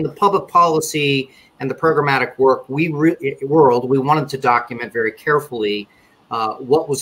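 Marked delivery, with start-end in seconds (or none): none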